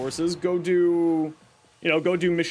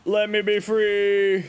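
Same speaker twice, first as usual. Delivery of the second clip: slow, higher and louder, stretching every word; drawn-out mock plea; 6 semitones higher, 5 dB louder, much slower than usual. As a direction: ham up the longing like a trapped office worker. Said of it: "You're like".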